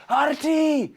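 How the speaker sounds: calling out in alarm; warning shout. Acted. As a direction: yell the name to warn him.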